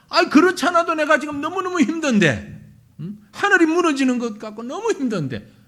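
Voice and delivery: loud, high sneering neighbour; needling neighbour. Retold or imitated. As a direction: rub it in with a cluck. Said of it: "불난데 부채질하는 것도 아니고". (옆에서 약올리는 이웃의 높고 큰 비아냥)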